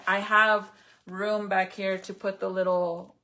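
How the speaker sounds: normal talking voice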